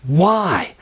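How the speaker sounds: ordinary delivery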